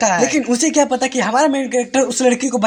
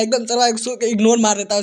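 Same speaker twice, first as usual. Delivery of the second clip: plain reading voice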